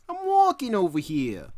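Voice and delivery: put-on loud, high, over-the-top New York accent. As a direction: holler it like a caricature New Yorker. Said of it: "never heard anybody talk like that".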